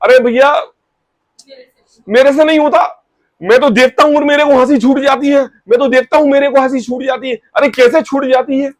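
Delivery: mock the giggly friend who can't help it; high-pitched pleading excuse; high, helpless friend. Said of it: "वो क्या कह रहा है".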